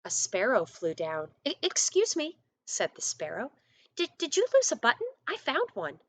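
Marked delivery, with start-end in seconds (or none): 1.4-2.4 sparrow voice. Acted. The sparrow's voice in story. 4.0-6.0 sparrow voice. Acted. The sparrow's voice in story.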